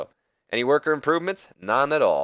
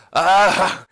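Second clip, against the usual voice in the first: loud, high fake laugh; mock wrestler's cackle, pitched up and loud; Stone Cold Steve Austin; 8 semitones higher, 7 dB louder than usual. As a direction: imitate a hysterical fake laugh in one burst. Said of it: "Like that fake laugh Austin always did".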